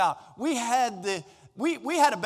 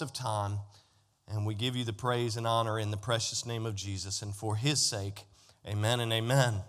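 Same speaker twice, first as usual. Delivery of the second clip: low, hushed pastoral prayer; 8 semitones lower, 3 dB quieter than usual. Acted. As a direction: pray reverently over the congregation, pitched down. Pastor prays before the sermon.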